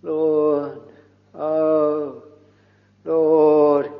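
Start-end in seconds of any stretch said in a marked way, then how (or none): none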